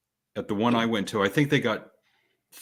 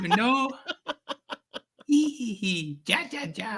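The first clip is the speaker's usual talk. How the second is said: much higher, soft, giggly swapped voice; Bolo voice swapped; 11 semitones higher, 4 dB quieter than usual. Retold or imitated. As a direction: squeak it out way up high. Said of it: "And Bolo sound like this".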